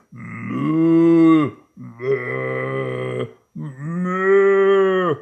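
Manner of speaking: three long, drawn-out moos; cows mooing. Acted. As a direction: moo like several cows at the fence. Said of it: "And they say".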